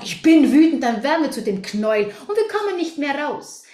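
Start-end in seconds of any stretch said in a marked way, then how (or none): 0.0-0.8 "ich bin wütend" higher and louder, rising on "bin"; caught in anger. Shown as a demonstration. Coach shows how "ich bin wütend" traps you, said loud and high. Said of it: "wenn wir sagen".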